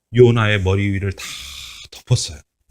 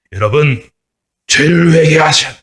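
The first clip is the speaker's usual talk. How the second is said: serious, pleading preacher call; earnest, pleading prophet's call; 4 semitones higher, 5 dB louder than usual. Shown as a demonstration. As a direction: urge them earnestly to repent. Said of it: "이렇게 진지하게 이야기하는데".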